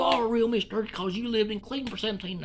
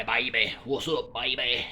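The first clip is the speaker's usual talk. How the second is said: put-on lower, laid-back "baby" greeting; a hint of a Houston rapper; 7 semitones lower, slower than usual. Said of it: "be like [name]".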